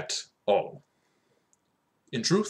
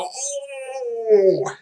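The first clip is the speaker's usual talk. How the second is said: hammed-up amusement; 6 dB louder than usual. Mock reaction to the punchline.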